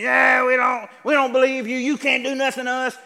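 high, loud jeer of defiance; defiant mock shout. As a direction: shout it like fist-shaking rebels. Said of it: "Men shaking their fists in the face of God".

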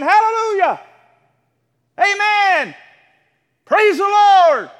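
loud, high exclaiming of praise; joyful child's shout. Pretend. Children shouting praise around the house, loud and joyful. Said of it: "Your little babies ought to be running around the house saying".

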